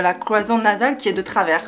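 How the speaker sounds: usual speaking voice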